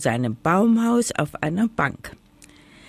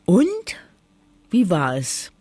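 curious. Acted. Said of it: "erkundigte sich die Schwalbe neugierig".

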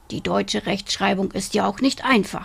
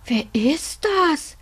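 high, slow, wide-eyed question; startled boy; 8 semitones higher, slower than usual. Acted. Robert, startled awake, asks grandpa who the dragon is, high and slow. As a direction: ask it as a small frightened boy.

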